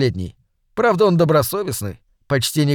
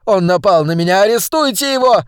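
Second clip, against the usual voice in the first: rising higher and louder, frantic; shrill demand; 6 semitones higher, 4 dB louder than usual. Acted. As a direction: demand the arrest in a panic. Victim demands arrest.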